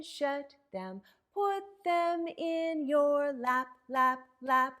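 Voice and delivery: put-on bouncy chanted action rhyme for kids; sing-song rhyme. Librarian leading children in a warm-up rhyme, sing-song. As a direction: lilt it like a nursery chant.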